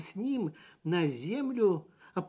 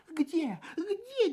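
incredulous; 11 semitones higher than usual. A narrator's reading. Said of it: "недоверчиво поднял голову".

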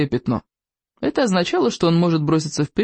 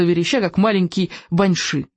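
ordinary delivery, nothing marked

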